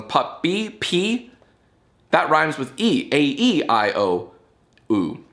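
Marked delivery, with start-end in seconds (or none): 0.0-1.3 "puppy" raised high, then "P" alone, higher still; "puppy, P" with the last sound singled out on a high pitch; final syllable isolated. Shown as a demonstration. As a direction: break off the end of "puppy" and repeat it high as "P". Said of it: "the final syllable of this one".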